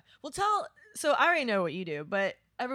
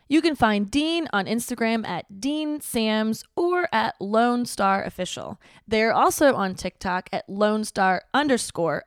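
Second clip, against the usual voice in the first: podcast intro read; 7 dB louder than usual. Acted. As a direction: read it like a radio show opener.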